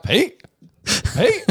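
high, searching call of Pete, Pete. Pretend. Host calling out for short Pete, mock high search. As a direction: pretend to look over his head, calling. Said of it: "I'll be like".

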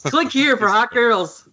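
high, slow cheesy pop-up ad; spam ad voice. Retold or imitated. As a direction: read it like a sleazy spam banner. Mimicking a spam ad.

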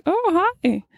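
high, sweet hello; sister's sweet greeting. Retold or imitated. Sister greeting a truck driver, high and sweet. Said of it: "she goes".

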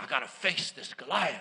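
quiet resolve. Retold or imitated. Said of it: "I said".

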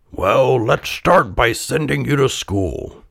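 lightly voiced old carpenter, deciding; put-on parental voice; Geppetto. A narrator's reading. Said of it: "said Geppetto".

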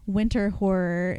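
ordinary talk, nothing marked

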